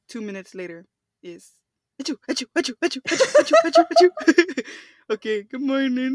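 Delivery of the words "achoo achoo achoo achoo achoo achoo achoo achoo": rising string of mock achoos; rapid imitated sneezes. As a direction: imitate a burst of sneezes, building up.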